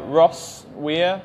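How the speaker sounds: slow, letter by letter; spelling out slowly. Shown as a demonstration. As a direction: spell the name slowly and clearly. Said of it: "could you spell your nick Facebook?".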